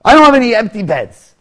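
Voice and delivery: loud, high innkeeper yelling; acted irritated shout; annoyed refusal. Innkeeper turns strangers away.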